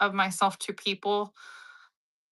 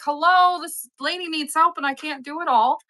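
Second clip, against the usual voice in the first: calling out; 6 semitones higher than usual. Retold or imitated. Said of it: "and I'm just like".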